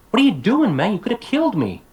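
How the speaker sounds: high, loud, mock-indignant exclamation; alarmed outburst. Teacher acting an example sentence, mock outrage. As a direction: burst out in exaggerated alarm, pitch leaping.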